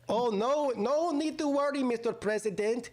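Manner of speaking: high-pitched mock Pakistani accent; badly faked Pakistani accent, pitched well up. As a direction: put on a terrible Pakistani accent. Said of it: "That was the worst Pakistani accent".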